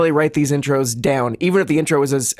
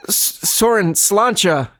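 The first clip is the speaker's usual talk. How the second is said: put-on higher, hammy Irish accent; 5 semitones higher, slower than usual. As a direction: toast him in an Irish accent. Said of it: "leap into an Irish accent".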